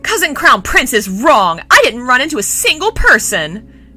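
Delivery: loud, high indignant prince; furious protest. Acted. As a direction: shout down the accusation. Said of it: "Qi Rong was furious".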